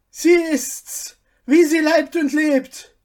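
high loud joyful cry; excited chorus. A narrator's reading. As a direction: cry out together, recognizing her. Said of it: "rief der Chor der Schwarzen".

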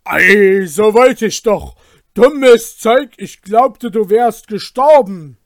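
loud, startled outcry; shouting. Acted. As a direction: blurt it out in shock and cover it. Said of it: "rief Wolf".

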